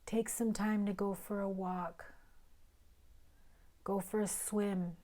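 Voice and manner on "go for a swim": normal talking voice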